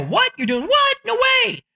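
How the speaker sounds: high, incredulous mock disbelief; astonished listeners. At a networking event, people hearing about your puppy book, disbelieving. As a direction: imitate people who can't believe it. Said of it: "They're gonna go".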